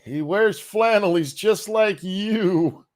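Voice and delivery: high, slow sarcastic voice; sarcastic mock voice. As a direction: ham up a folksy ad.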